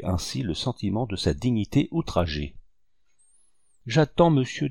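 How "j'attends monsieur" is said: curt reply. A narrator's reading. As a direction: cut him off with two cold words. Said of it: "d'une voix brève". (voix brève et sèche)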